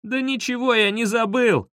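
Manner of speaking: high-pitched, exasperated cry; despairing high yelp; desperate wail. A narrator's reading. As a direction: wail in despair without much extra volume. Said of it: "в отчаянии завопил я".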